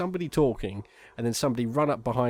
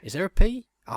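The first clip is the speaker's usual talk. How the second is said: higher, louder, rising mock-puzzled question; 9 semitones higher, 7 dB louder than usual. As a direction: play the graffiti writer wondering how to spell the name. Acting the graffiti writer's doubt.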